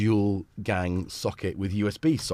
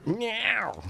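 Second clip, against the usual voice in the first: high, drawn-out cat meow; imitated meow. Streamer playing the cat during a break, high mock meow.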